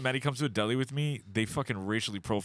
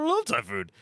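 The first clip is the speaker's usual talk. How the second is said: high, eager showing off; eager boast; 6 semitones higher, slower than usual. Retold or imitated. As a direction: brag to impress a date. Boasting to impress date.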